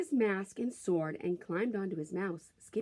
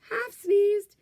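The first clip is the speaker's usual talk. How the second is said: mock sneeze; 12 semitones higher, 11 dB louder than usual. A narrator's reading. Sneezing the password.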